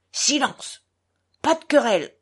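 peremptory shout; imperious cry. A narrator's reading. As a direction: cut off the quarrel sharply. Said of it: "cria impérieusement Grouchenka".

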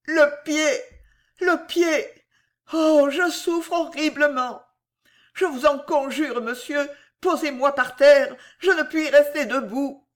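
high, faint-sounding moan of pain; dying voice. A narrator's reading. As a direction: groan out the pain, lightly voiced. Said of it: "murmura d'une voix mourante".

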